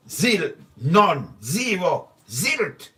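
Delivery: each word punched, "Zil", "none", "zero", "zilt" spaced and stressed; emphatic zero. Real, not acted. Speaker answers his own question about lost money, punching each word. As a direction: hammer home that nothing was lost.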